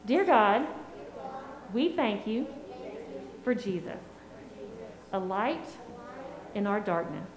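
soft prayer in short phrases, with pauses. Acted. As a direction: lead little kids through a prayer, phrase by phrase. Children repeat prayer after her.

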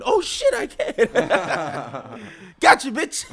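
high, loud gleeful squeal; laughing, squeaky triumph; gleeful surprise. Retold or imitated. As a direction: jump up in delight at a discovery. Retelling own surprised delight.

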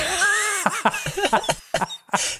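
high, helpless cackle; bursting out laughing. Real, not acted. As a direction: lose it completely at the joke. Cracks up at Nazi Trina joke.

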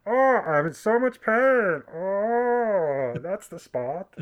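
high, slow, moaning mock agony; badly faked agony that slides into pervy pleasure; Bane. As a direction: draw out the groans, hamming up the pain. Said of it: "that's Bane in agony".

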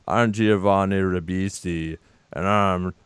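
lightly voiced Ribisi mumble, dropped low; Giovanni Ribisi impression. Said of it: "This is how he talked. I'm gonna try this out".

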